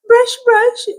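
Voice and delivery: high whiny mock toddler cry; imitating crying toddler. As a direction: whimper it like a small child wanting a toothbrush. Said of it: "while crying".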